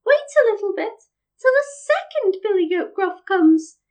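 high, coaxing little goat; small goat voice. A narrator's reading. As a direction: bargain in a tiny high voice. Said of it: "with such a small voice".

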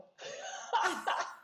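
high bright laugh. Real, not acted. Laughs after the birth.

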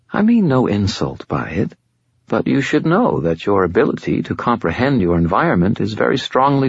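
fallen angel Lashiel. Acted. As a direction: speak as a patient otherworldly being, a little above it all. Lashiel speaks.

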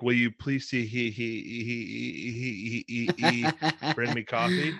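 please read as please see he he he, stuttering out the stray E's; mock stammered he he he; misread please. Shown as a demonstration. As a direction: read "please" with each E as a separate "he". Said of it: "So it read like".

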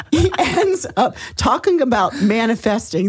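laughing through the words. Real, not acted. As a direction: chuckle as you say it. Laughing while telling ending.

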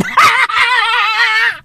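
high, long burst of laughter; high-pitched cackle. Real, not acted. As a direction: burst out laughing at your own joke. Cracking up at own joke.

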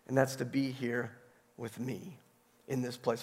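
soft, low, loving reassurance; Jesus. Retold or imitated. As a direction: tell it gently and unhurried, as a loving teacher. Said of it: "Jesus just lovingly tells her".

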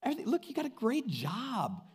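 high, breezy fake-friendly reassurance; butter them up like a hollow church friend; mock flatterer. Laodicean churchgoer flattering a seeker, high and breezy. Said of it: "here's what you'd hear".

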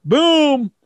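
ordinary delivery

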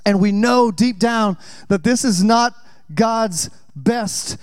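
usual speaking voice

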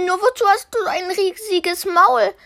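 höher und zügig gefragt; fragendes Mädchen. Acted. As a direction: frag ahnungslos nach dem Maul. Mädchen fragt. (Blaukleidchen fragt nach dem Maul, höhere Stimme)

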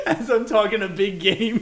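high, loud, speaking through laughter; laughing. Real, not acted. Laughing at being tricked.

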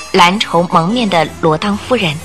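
ordinary delivery

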